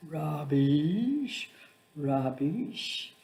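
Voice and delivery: put-on a child parroting an adult's word; lightly voiced child mimicking her carer; child copying my voice. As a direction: echo the word back like a little parrot. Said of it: "in my voice".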